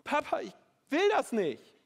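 high, loud, desperate cry to his father; anguished plea. Acted. Jesus on his knees, pleading with his father, crying out high and loud. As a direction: beg from your knees in anguish. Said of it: "Jesus fällt auf die Knie und er sagt".

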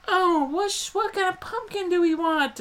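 mock the cheery family shopping; family member voice. Family picking pumpkins in the trailer, high mock voice. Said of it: "his family is like".

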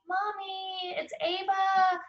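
put-on high little toddler voice, pleading; sweet baby voice. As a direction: imitate a two-year-old begging at the door. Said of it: "Her sweet little baby voice".

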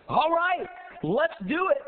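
high, excited go-ahead; eager agreement. Acted. Ducks agreeing to carry the frog, eagerly. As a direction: pitch it up, all in on the plan.

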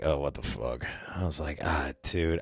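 low, very soft retold grumble; under-the-breath dismay; muttered dread. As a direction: mutter it to yourself, unwilling. Retelling his private dread.

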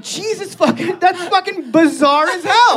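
high-pitched, half-laughing astonishment; amazed. Retold or imitated. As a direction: exclaim in gleeful disbelief. Teller acts own amazed reaction.

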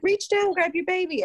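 high, bright encouraging call; coaching her to reach. Retold or imitated. Midwife coaching mother at the birth, high encouraging call. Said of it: "I was like".

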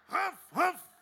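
loud high mock bark; dog bark. Big dog barking at the pastor, loud bark.

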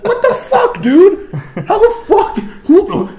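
very high, loud incredulous yelling; exasperated outrage. Real, not acted. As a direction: splutter loudly in comic disbelief. Comic outrage at the rumour.

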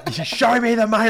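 high, loud "Show me the money"; celebrating the win at a Friday barbecue, yelling; triumphant yell. Retold or imitated. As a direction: shout it like a jackpot win. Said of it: "yelling kind of moment thing".

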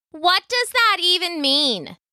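high bewildered call. A narrator's reading. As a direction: call out across the pack. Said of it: "called out the boxer".

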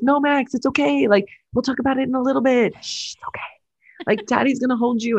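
calm Max down like a parent, lightly voiced. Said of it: "he's like".

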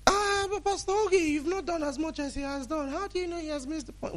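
high-pitched protesting voice; mimicked objector. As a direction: put on a high voice, softening as you object. Mimics someone objecting to his verdict.

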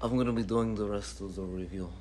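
put-on Russian accent. Host threatening to do the rest of the review, mock Russian accent. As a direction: play at talking like a Russian. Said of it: "with the Russian accent".

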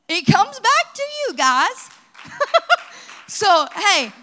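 high, loud laughing delight; excited laugh. Real, not acted. Pastor laughing in triumph to the congregation. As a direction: burst out laughing with joy.

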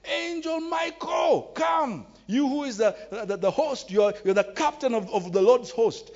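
hammed-up cry summoning Michael; mock calling. Mocking people calling on angels.

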